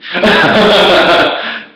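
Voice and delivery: a burst of loud, high laughter; a big laugh at his own panic. Real, not acted.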